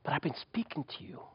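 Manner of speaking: soft whisper. Acted. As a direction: whisper it tenderly. God's gentle words in the story.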